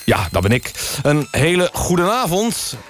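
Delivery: ordinary talk, nothing marked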